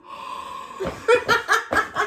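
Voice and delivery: high unvoiced laughing gasp; excited gasp. Real, not acted. Mom at peephole watching, gleeful gasp. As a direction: gasp with delight at the drama.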